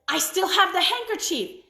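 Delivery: put-on girl remembering a rescue; eager girl. A narrator's reading. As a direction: blurt out the hopeful idea. Brigitte has an idea.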